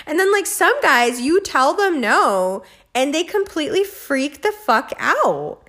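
normal talking voice

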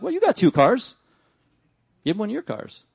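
God turning the request back; God's blunt reply. Retold or imitated. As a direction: answer like God calling you out. God answers the prayer.

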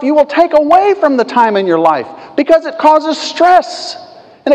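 building sermon fervor; preaching. Acted. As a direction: drive the point home from the pulpit. Rising into preaching.

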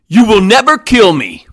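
usual speaking voice

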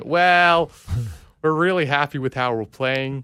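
hammed-up upbeat American manager; mock American manager. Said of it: "And then Jesse Marsch comes in".

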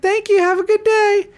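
squeaky drive-thru greeting; drive-thru worker. Retold or imitated. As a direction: chirp it like a cheerful fast-food cashier. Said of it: "She's like".

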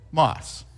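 "Moss" said alone as the third syllable, then a stop; a single clear syllable "Moss". Shown as a demonstration. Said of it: "syllable by syllable".

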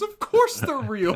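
lightly voiced indignant woman; mock exasperated woman. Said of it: "She goes".

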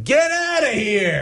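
high, slow mock shout of a beach guard; bossy beach patrol barking; gruff beach guard. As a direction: shoo them off like a cranky official.

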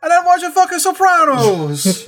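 high, loud mock Italian-American mobster; hammed-up Italian-American protest; Italian-American accent. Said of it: "words in the Italian accent".

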